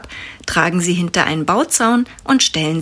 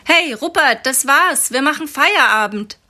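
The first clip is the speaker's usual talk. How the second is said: higher call across the square; 7 semitones higher than usual. Acted. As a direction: shout over to a colleague. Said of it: "ruft der Mann vom Lastwagen". (laut über den Platz gerufen)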